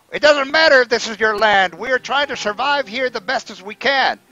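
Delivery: high, loud goblin argument; goblin leader. Acted. Goblin disputing the kobold's claim, high and insistent. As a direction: argue back as a high-voiced goblin. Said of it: "the goblin says".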